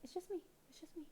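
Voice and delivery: high, soft reassuring voice; calm the frightened cat gently; soft reassurance. Real, not acted. Soothing cat softly.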